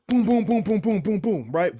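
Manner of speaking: loud, high booms like a fist on a door; imitated door banging. Retelling the roommate banging on his door, loud booming knocks. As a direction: pound out each boom like knocking. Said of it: "I wake up to banging".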